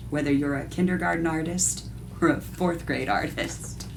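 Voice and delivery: usual speaking voice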